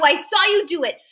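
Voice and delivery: high, insistent accusing cry; screaming. A narrator's reading. Boy screaming at his father as witness, screaming. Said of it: "all I can do is keep screaming".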